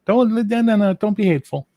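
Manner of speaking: very high, loud playful protest; mock pleading. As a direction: squeal a mock plea to be nice. Playful plea during friends' mock quarrel.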